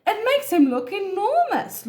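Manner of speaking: high-pitched, lightly laughing character read; mock-amused Ostrich. A narrator's reading. Ostrich laughs at Elephant's size, lightly amused. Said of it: "laughed Ostrich".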